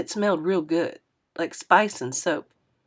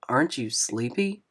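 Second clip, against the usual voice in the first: a hint of a gentle older driver; lightly voiced older man; 6 semitones lower, 5 dB quieter than usual. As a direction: lower and softer, kindly asking. Narrator voices Mister Lewis.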